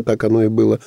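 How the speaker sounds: normal talking voice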